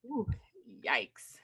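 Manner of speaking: breathy low whisper of yikes; hushed yikes. Real, not acted. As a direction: whisper it under your breath. Whispered reaction to gossip.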